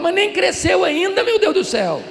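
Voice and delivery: high, loud, amused exclamation; laughing disbelief. Retold or imitated. Pastor laughing at the mother worried about her toddler. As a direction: laugh at the absurd worry.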